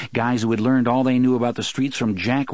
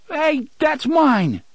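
high, slow, louder bleat; 4 dB louder, much slower than usual. A narrator's reading. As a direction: bleat it out like a whiny fat man. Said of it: "Fat Johnny bleated".

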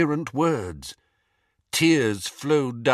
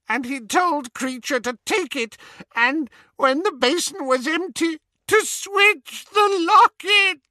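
high elf voice breaking into sobs; old elf sobbing through his master's story; sobbing house-elf; 4 dB louder than usual. Acted. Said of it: "Kreacher's sobs came in great rasps".

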